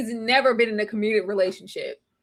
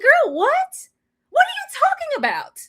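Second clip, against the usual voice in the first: high incredulous mock; 14 semitones higher than usual. Host voicing reaction to Cameron, high incredulous. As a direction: pitch way up in disbelief. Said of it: "It's like".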